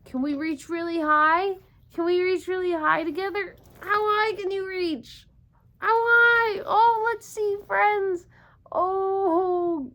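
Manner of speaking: high, loud cheering on; rally the kids to reach up; excited coaxing. Acted. A teacher cheers the children to reach really high.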